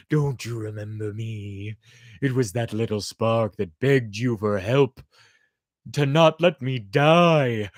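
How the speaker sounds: lightly voiced cold reproach; vengeful fire. A narrator's reading. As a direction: throw his cruelty back at him. Fire taunting the chick.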